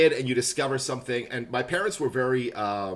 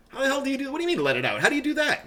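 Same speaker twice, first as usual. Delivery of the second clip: incredulous; 12 semitones higher, faster than usual. Real, not acted. Baffled by advice to emote.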